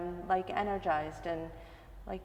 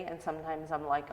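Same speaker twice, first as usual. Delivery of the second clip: ordinary talk, nothing marked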